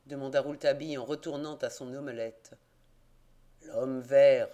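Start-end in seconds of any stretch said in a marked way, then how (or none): 3.6-4.5 low, loud growl. A narrator's reading. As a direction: growl the name with contempt. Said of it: "gronda l'aubergiste".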